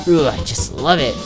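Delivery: normal talking voice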